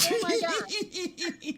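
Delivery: slow, laughing Oh my God; horrified at the ugly word, laughing; mock dismay. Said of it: "And it's like".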